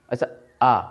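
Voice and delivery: आ said with the mouth wide open; a long open आ, shown to the class; open-mouth demo. Shown as a demonstration. Teacher showing students the open mouth for आ. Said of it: "आप मुंह ऐसा खोल लीजिए".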